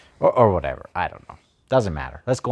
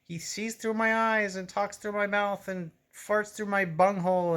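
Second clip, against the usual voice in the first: Pust impression; 12 semitones higher than usual. Reader imitating Pust announcing Shadowthrone's message, hammed-up. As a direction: ham up the pompous priest proclaiming his god. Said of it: "Pust is like".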